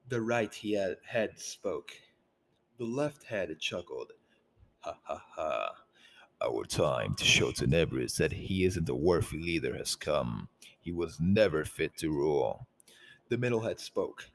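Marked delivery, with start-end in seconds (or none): 4.8-12.7 low layered menacing monster voice; many snarling voices overlapping. Acted. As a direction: growl it as a three-headed beast. Three-headed Horribilis speaks.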